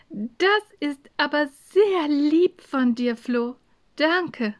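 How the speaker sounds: sweet, lingering appreciation; sweetly grateful. Acted. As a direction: say thank you as kindly as you can. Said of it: "Farah sagte das so nett".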